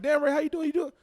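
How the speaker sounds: high, loud mock concern; pitying friend. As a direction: imitate someone shocked by how bad he looks. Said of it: "I don't want people to see me like".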